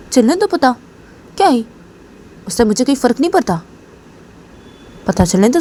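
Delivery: defiant reassurance. Acted. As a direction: brush off her fear boldly. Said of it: "तो तभी काजल रेशमा को देखी".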